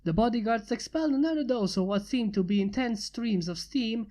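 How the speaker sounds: plain reading voice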